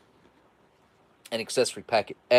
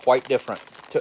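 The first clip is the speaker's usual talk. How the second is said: ordinary talk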